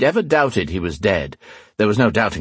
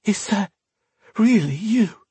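astonished disbelief; 12 semitones higher than usual. Acted. Shocked recognition of the priest.

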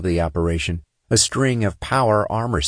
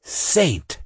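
growled; 8 semitones higher than usual. A narrator's reading. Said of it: "Sinner growled".

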